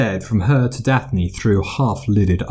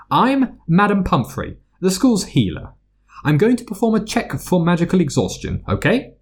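higher, brisk school nurse; brisk matronly healer; 9 semitones higher than usual. A narrator's reading. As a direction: bustle through it like a busy matron. Healer introduces herself.

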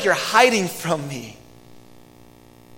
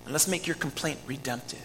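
gentle invitation; 3 semitones lower, 8 dB quieter than usual. Acted. God's invitation voiced gently.

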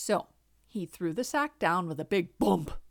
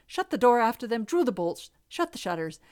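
plain reading voice

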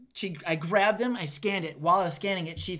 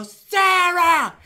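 yelling across store; 11 semitones higher, 16 dB louder, slower than usual. Retold or imitated.

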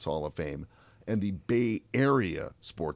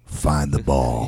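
low, slow, steady coach advice; deep and unhurried, like a veteran; warm old man; 6 semitones lower, 9 dB louder, much slower than usual. Retold or imitated. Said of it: "warm, warm old man there".

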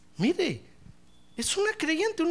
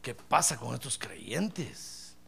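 weary prayer; 9 semitones lower, 8 dB quieter, much slower than usual. Retold or imitated. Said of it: "que uno dice".

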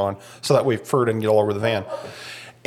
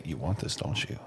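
teasing dog; 7 semitones lower, 13 dB quieter than usual. Pretend. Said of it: "she'll go up and shove it in his face and say".

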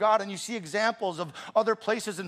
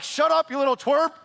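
mock snap; 6 semitones higher, 6 dB louder than usual. Pastor retells snapping at son.

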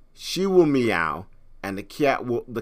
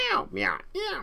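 squeaky high mimicked meows, softer; high cat meows; 5 dB quieter than usual. Mother imitating her daughter meowing with the cat. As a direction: meow up high like a kitten. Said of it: "they have a meow".

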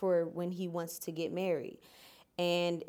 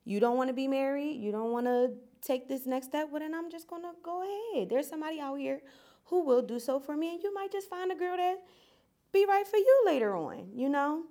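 higher, fed up, telling him off; retold breakup speech; 7 semitones higher than usual. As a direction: lay down an ultimatum, pitched up. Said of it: "I was like".

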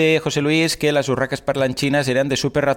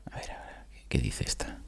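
susurro; 13 semitones lower, 16 dB quieter than usual. Real, not acted. Susurra aparte antes de escuchar.

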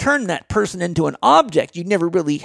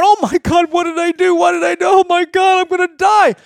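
much higher, shouted panic; high, loud mock panic of a recruit; panicked soldier; 11 semitones higher, 5 dB louder than usual. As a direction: scream it like a terrified young soldier under fire. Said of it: "there's freaking out, going".

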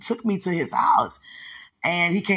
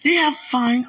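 1 excited; 9 semitones higher, 5 dB louder than usual. Real, not acted.

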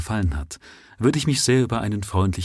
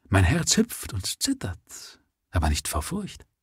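whispered confession; 4 dB quieter than usual. A narrator's reading.

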